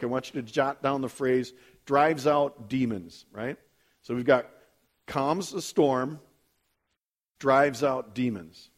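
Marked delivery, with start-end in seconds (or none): 1.9-3.3 slow, deliberate phrase for note-takers; slow dictation. Real, not acted. Preacher giving a phrase to jot down, slow dictation. 5.1-8.7 drawn-out, deliberate list of phrases; slow recap. Real, not acted. Preacher recapping the noted phrases, slow dictation.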